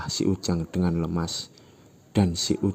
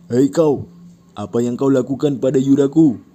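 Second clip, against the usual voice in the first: loud challenge; 6 semitones higher, 9 dB louder than usual. Acted. Fat guy confronts Ujang.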